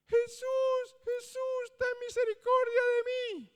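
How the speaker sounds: high, loud, long-held calls for mercy; desperate cry. Acted. Sick man calling to Jesus from the crowd, high desperate wail. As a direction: cry out to Jesus from far down the road.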